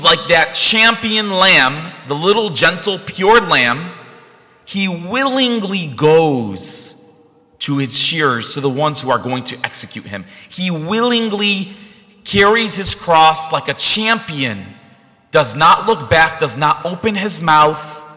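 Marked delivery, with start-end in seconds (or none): 4.6-8.5 reverent. Real, not acted. Preaching on Christ's sacrifice. 10.5-14.6 reverent. Real, not acted. Preaching on Christ's sacrifice.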